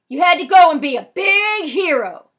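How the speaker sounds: high sarcastic shout; angry yell. A narrator's reading. Frosty yells at the guy who grabbed the knife, furious high shout. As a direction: yell it as a scornful accusation. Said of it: "points his gun at the guy and yells".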